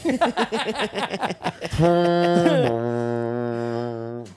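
long, low foghorn drone; foghorn imitation. Comics mocking Dan's voice on a podcast, foghorn blast. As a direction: blare a drawn-out foghorn note. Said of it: "an hour with a foghorn".

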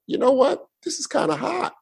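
mock-seductive woman; imitating a woman. A woman visiting his apartment, mock-flirty high voice. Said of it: "women would come over and be like".

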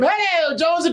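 high friendly hail; sweet greeting. Retold or imitated. Mister Gray greeting Jonesy sweetly, high. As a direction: call out all sweetness. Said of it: "he went from".

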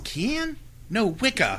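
high, prim correction; prim English lady. Retold or imitated. Mother-in-law correcting the furniture man, prim and high. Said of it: "And she goes".

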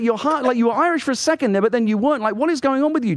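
high-pitched mock exasperation; exasperated challenge. Confronts character about his accent.

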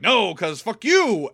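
slow, loud mock comeback; drawn-out acted refusal, punched hard; defiant retort. Picard refusing to leave Crusher, mock defiant.

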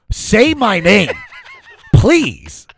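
loud, high comic begging; desperate high-pitched plea; pleading. Retold or imitated. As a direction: beg for it, stretched out and loud. Retold plea, played for laughs.

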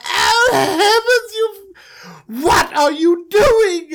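very high, slow, crying mock wail; shrieking dismay. Joseph, kicked into the pit, wails high at his brothers. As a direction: wail like a pampered victim.

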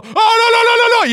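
high, loud hammed-up wail of no; mock panic. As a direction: cry out as if vanishing, in pretend horror. Acts out himself panicking as he vanishes. (pánico fingido, grito agudo)

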